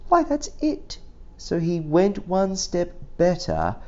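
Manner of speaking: plain reading voice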